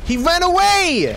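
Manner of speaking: high, loud disbelieving shout; shocked yell. Real, not acted. Enemy flees, streamer yells.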